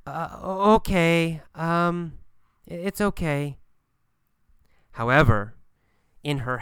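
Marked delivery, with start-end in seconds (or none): 0.0-3.6 halting, stammered and unsure; hesitant. Acted. Kathy, unsettled, gives in to Gabriel, hesitant. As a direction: stumble over the first sounds, pausing between words. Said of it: "she says hesitantly".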